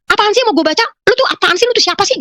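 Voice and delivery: high, exasperated questioning; irritated challenge. Retold or imitated. Woman challenging the unseen voice, irritated. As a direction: demand answers in a high, annoyed voice.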